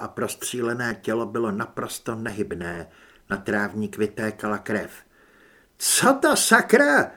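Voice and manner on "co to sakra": high, loud, drawn-out shocked yell; shouting. A narrator's reading. Woman running to the gazebo shouts in shock. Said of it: "křičela Holly".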